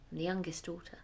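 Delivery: plain reading voice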